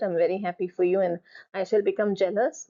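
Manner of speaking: normal talking voice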